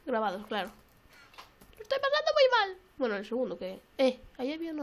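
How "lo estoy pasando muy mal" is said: high, loud comic wail; high-pitched complaint; distressed whine. Real, not acted. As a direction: wail your misery up high. Complaining about the stressful game.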